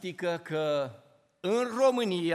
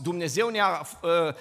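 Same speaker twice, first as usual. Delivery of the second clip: ordinary talk, nothing marked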